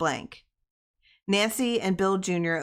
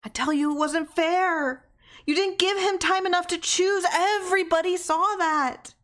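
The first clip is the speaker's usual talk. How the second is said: high, loud, strained cry; desperate protest; 13 semitones higher, 4 dB louder than usual. A narrator's reading. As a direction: plead against fate.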